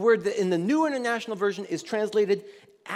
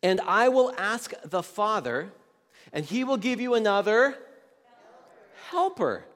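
scripture reading; 4 dB louder than usual. Acted. Reads scripture aloud.

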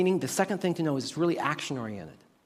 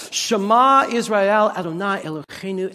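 usual speaking voice

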